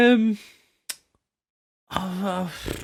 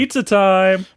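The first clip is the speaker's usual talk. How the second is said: excited shout; 8 semitones higher, 6 dB louder, slower than usual. Acted. Gleeful pizza catchphrase shout.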